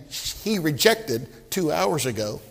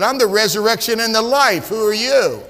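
commanding retort; 4 semitones higher, 6 dB louder than usual. Acted. Jesus answering the challenging preacher.

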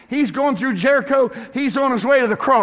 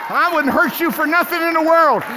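ordinary talk

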